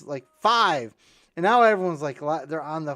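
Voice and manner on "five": louder, punchy call; old guy calling out a joke number. Retold or imitated. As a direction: pop the number out. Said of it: "jumps up and says".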